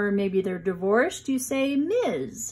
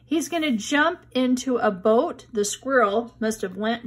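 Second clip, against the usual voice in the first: ordinary delivery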